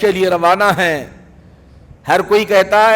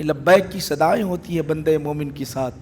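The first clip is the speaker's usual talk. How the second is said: ordinary talk, nothing marked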